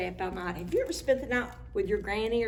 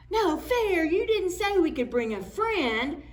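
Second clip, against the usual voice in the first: high whiny complaint; 9 semitones higher, 4 dB louder than usual. Acted. Tim character complains.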